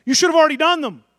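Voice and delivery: loud and high, pressing the point; a preacher's loud rebuke; forceful preaching. Real, not acted. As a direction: drive it home loudly.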